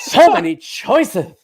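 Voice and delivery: high, slow, dreamy camp line with a laugh; laughing mock pharaoh. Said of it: "he's just like".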